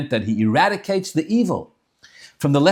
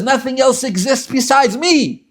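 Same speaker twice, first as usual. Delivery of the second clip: boastful evil inclination; 10 semitones higher, 5 dB louder than usual. Acted. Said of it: "the Yetzer Hara says".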